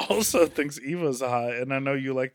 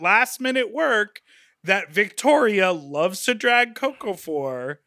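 narrator voice; 5 semitones higher, 4 dB louder, much slower than usual. Pretend. Mock documentary narration.

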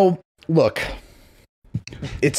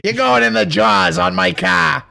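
shouted Boston threat; 4 semitones higher than usual. Retold or imitated. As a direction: roar it at the kid, Boston tough. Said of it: "great Boston accent".

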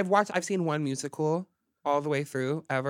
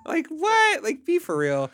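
high, loud burst of disbelief; incredulous; 13 semitones higher, 6 dB louder, slower than usual. Acted. Host reacting to expected dirty talk, incredulous. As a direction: blurt it out in mock disbelief.

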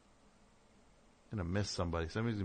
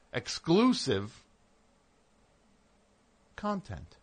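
slow, drawn-out salesman emphasis on exclusive; drawn-out promo read; mock grand pitch; 6 semitones higher, slower than usual. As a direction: lay it on like a pitchman. Hyping the Patreon perk.

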